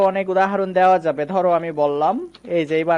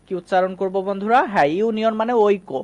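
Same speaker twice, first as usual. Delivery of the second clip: usual speaking voice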